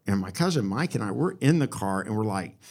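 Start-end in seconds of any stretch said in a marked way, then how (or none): none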